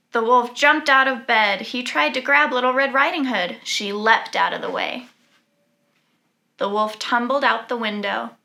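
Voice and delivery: usual speaking voice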